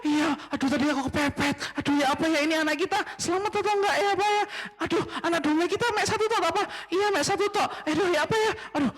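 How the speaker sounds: high, fretful voice of a nervous dad; anxious father asking his wife; worried father. Acted. Frantic couple bickering.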